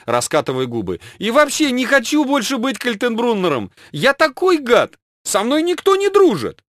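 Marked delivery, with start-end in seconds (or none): 1.2-6.6 hammed-up whining; whiny villain. A narrator's reading. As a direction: wail like a sulking child. Kaltenbrunner whining self-pity.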